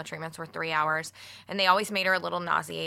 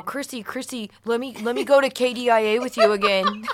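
high, pleading mock voice, half laughing; mocking whiny plea; 5 semitones higher, 7 dB louder than usual. Teasing a friend by mimicking his begging. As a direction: beg like a clingy friend, laughing. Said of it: "he's gonna go".

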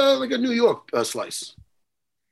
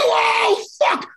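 much higher sudden outburst of remembering; retold realisation; 13 semitones higher than usual. Retelling his call with the pizza shop, remembered outburst. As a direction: blurt it out as it hits you. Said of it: "I go".

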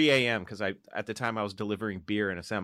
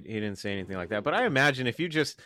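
ordinary talk, nothing marked

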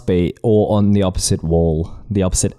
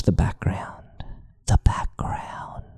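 hushed spooky whisper; 2 semitones lower, 8 dB quieter, much slower than usual. Acted. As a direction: breathe it like a secret. Spooky hint to watch background.

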